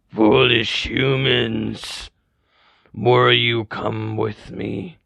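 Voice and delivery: slow, drawn-out menacing zombie growl; monster voice. Acted. Zombie taunting the humans, slow menacing monster voice. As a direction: loom over them, savoring each word.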